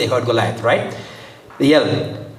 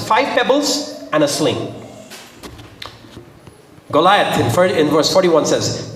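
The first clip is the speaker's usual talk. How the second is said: usual speaking voice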